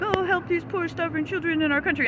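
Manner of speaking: high, syrupy mock pleading of a charity ad. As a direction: parody a fake charity commercial. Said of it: "fake charity commercials".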